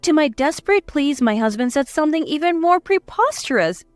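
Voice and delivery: usual speaking voice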